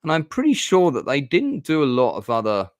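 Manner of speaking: high, excitable register; suddenly high-pitched. Real, not acted. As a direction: voice shooting up high mid-thought. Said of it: "my voice just went really high pitched".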